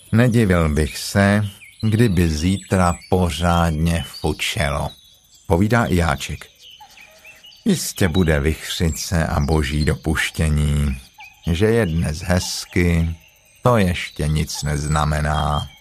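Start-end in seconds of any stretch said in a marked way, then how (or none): 0.1-4.9 slow, low donkey voice; slow, dismal forecast; gloomy Eeyore. Acted. Gloomy Eeyore predicts bad weather. 7.7-15.7 slow, low donkey voice; slow, dismal forecast; gloomy Eeyore. Acted. Gloomy Eeyore predicts storms.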